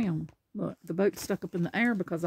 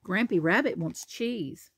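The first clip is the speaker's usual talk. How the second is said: plain reading voice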